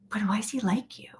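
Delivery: hushed, secretive question; soft whispered aside. Real, not acted. Conspiratorial question.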